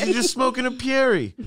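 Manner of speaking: very high imitation British voice; British accent. British guy repeating it to the baffled American, imitated British accent. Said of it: "you got a pretty good accent there".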